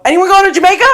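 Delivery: loud, high call to a group of strangers. Acted. Traveller asking a taxi rank who shares a ride, calling out. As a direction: shout the question out to everyone around.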